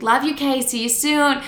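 high, airy mock-casual farewell; breezy casual goodbye. Imitating mom leaving the psych ward, breezily casual. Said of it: "so casually".

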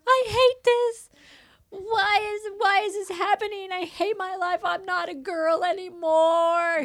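high, loud wail imitating a crying child; mock crying. Daughter crying to mom over her first period, mock wailing. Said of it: "she was crying for, like, five days straight".

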